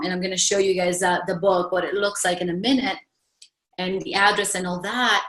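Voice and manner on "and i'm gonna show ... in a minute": usual speaking voice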